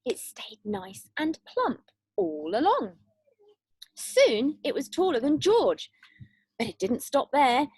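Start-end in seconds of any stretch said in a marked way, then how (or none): none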